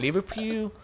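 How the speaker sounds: mock the Liverpool accent on Liverpool, badly; bad Scouse accent. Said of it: "That was bad as well".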